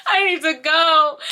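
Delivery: loud frantic cry to get out; half-crying panic to run; panicked cry. Retold or imitated. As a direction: shout it like you need to escape now. Panicked urge to flee.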